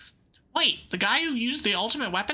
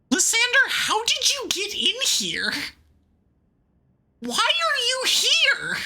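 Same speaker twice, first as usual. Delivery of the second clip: shocked exclaim; 9 semitones higher than usual. Acted. Character shocked at villain's arrival, high alarmed calls. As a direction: gasp out his name in alarm.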